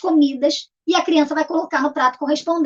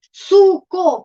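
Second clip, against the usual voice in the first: SUCO in two separated beats, SU, CO; syllable by syllable; 2 semitones higher, 5 dB louder than usual. Shown as a demonstration. Teacher gives a simple example, syllable by syllable. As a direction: break SUCO evenly after SU. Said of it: "Primeiro, palavras de sílabas".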